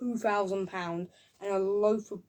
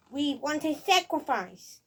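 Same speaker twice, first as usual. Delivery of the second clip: slow, higher mock demand; demanding knight; 5 semitones higher, slower than usual. Reviewer acting the knights' demand, slow and mock-solemn. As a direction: draw out the demand as a silly knight.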